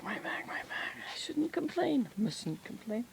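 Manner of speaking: a hushed, pained little moan about the back; breathy whispered grumble over a sore back; whispered complaint. Real, not acted. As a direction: whisper it to yourself, aching. Back pain, muttered complaint.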